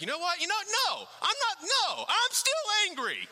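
high, loud, indignant outburst; mock self-righteous fury, pitched high; indignant rant. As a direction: act out a petty grudge flaring up. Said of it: "and you're like".

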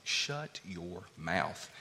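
slow, hushed whisper. Retold or imitated. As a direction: breathe it slowly, almost a secret. Whispered blunt paraphrase.

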